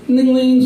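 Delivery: high, bright bell imitation; mock ding of a bell; trolley bell.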